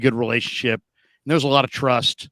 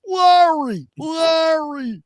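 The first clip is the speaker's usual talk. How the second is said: high squeaky Larry; high mocking call of the name; mock Trent Baalke; 13 semitones higher, much slower than usual. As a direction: stretch the name in a squeaky exec voice. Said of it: "He'd say".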